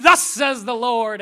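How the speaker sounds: loud, slow declaration of faith; bold proclamation. Retold or imitated. Preacher acting out bold faith, loud slow proclamation. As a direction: proclaim it like a prophet.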